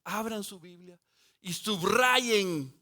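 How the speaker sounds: slow, drawn-out call to underline; unhurried preacher's instruction. Shown as a demonstration. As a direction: spell it out slowly for the congregation. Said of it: "subrayen, marquen esa cita".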